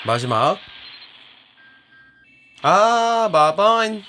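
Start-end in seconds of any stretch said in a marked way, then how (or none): 2.6-4.0 high loud startled call; surprised exclamation. Real, not acted. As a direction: react sharply to the pull. Pull reaction.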